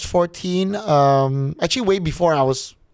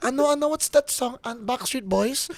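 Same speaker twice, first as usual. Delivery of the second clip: Filipino mother voice climbing higher; Filipino mom; 6 semitones higher than usual. Retold or imitated. Son mimicking his mom requesting a song, Filipino mom accent. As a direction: fuss like a Filipino mom picking songs. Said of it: "All Filipino moms sounds like that".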